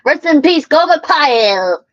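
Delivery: booming TV announcer again; shouted network tagline; loud promo. Pretend. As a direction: belt the tagline out. Repeats promo line.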